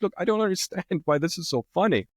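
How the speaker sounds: high-pitched mock bewilderment; retold bewilderment. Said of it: "I was completely bewildered".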